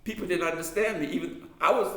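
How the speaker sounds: ordinary delivery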